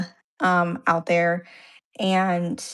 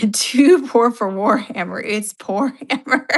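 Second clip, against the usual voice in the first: amused, higher voice breaking into laughter; laughing; 4 semitones higher, 4 dB louder than usual. Real, not acted. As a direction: laugh through the words. Laughing at a joke about Warhammer.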